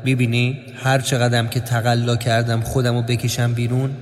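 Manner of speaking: low, even reflective narration; low-pitched, steady voice-over aside; inner monologue. A narrator's reading. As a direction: drop low and confide a memory. Inner reflection set apart from narration.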